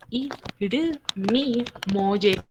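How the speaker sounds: "Irumi mo yeyo" very slow, syllable by syllable; slow model pronunciation. Shown as a demonstration. Teacher modelling the Korean phrase slowly for students. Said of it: "viene siendo irumi mo yeyo".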